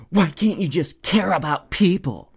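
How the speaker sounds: high, strained imitation of an upset scold; hammed-up exasperated plea in an altered voice; mock unglued outburst. Stranger pleads with the maskless shopper, mock unglued. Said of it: "kinda came unglued".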